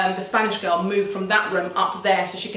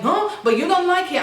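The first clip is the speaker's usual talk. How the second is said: high mocking protest; mimicked angry housemate; 9 semitones higher, 3 dB louder than usual. Spanish girl argues back about the dryer, mocking imitation. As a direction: mimic her objecting, pitch thrown up. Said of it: "And then she was like".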